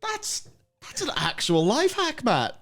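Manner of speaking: high amazed exclamation. Real, not acted. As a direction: gush in high disbelief. Amazed at the trick.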